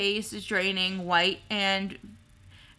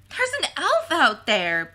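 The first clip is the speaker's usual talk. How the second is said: put-on blurted high and loud, boy's sudden alarm; excited exclamation; 6 semitones higher, 5 dB louder than usual. A narrator's reading. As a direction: call it out through a mouthful. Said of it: "Peter exclaimed with his mouth full".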